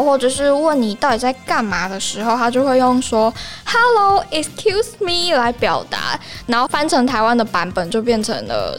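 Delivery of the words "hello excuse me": American "hello, excuse me". Retold or imitated.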